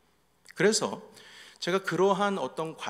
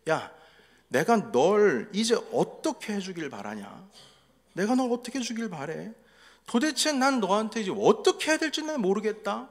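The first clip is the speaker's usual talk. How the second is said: much higher and louder, yelling at the son in frustration; angry shout; 8 semitones higher, 5 dB louder than usual. Retold or imitated. Father yelling at his teenage son mid-argument, angry shout. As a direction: burst out shouting, at a loss what to do. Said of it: "이렇게 버럭 소리를 질렀죠".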